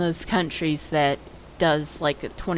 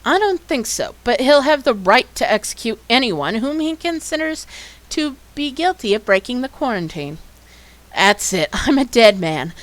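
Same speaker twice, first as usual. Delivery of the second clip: higher, louder character reading; dialogue reading; 5 semitones higher, 4 dB louder than usual. Acted. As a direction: voice the characters with energy. Voices game dialogue aloud.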